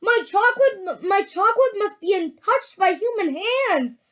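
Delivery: high-pitched, pleading cry. A narrator's reading. Wonka begging Augustus to stop, crying out high. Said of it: "he cried out".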